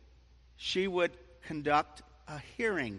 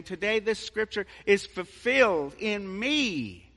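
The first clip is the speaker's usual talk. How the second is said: proclaiming; 5 semitones higher, 7 dB louder than usual. Acted. Jesus proclaims himself Messiah.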